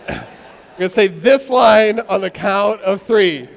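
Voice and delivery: loud, slowed announcement over laughter; calling out. Real, not acted. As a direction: call it out to the whole room.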